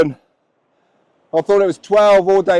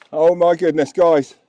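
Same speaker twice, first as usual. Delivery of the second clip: normal talking voice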